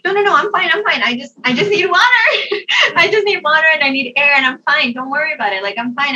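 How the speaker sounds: high, loud, flustered protest; acted panicky insistence. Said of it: "I start panicking again".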